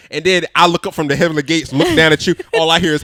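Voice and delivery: ordinary talk, nothing marked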